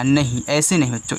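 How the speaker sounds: ordinary delivery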